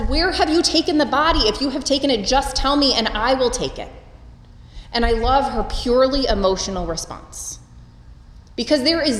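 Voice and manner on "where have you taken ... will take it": mild mock yell, pitched higher; a touch of Mary's frantic demand; retold yell. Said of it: "And she yells at him".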